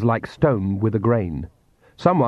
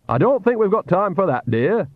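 high, put-on old woman's voice; a villain faking a kindly old nanny, pitched up; pitched-up imitation of an old woman, a touch overdone; fake Nanny Ogg voice; 9 semitones higher than usual. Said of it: "said the voice".

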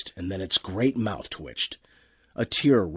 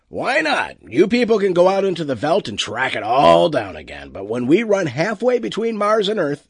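louder, higher, expansive captain's retort; brash spaceship captain, dismissive; 7 semitones higher, 5 dB louder than usual. Acted. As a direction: brush off the objection loudly as the captain.

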